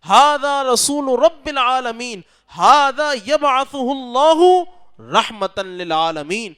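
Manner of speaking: high, loud and slow proclamation; retold shouting monk. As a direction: cry it out like the alarmed old monk. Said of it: "وہ چیخنے لگا اور زور زور سے کہنے لگا".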